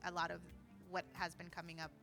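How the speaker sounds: ordinary talk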